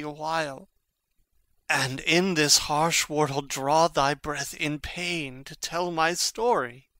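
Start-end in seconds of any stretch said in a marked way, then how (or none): none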